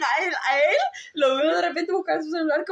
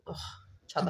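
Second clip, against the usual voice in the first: ordinary delivery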